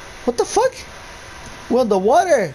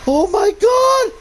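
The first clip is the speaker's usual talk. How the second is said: loud, high-pitched shrieking; terrified screams; 7 dB louder, much slower than usual. Real, not acted. Player caught by a game scare, terrified screaming. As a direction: scream it out in fright.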